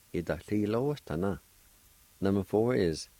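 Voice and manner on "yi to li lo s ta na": Yi to li lo s ta na run together fast; quick natural pace. Shown as a demonstration. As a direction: say Yi to li lo s ta na briskly as in speech. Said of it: "is pronounced like this".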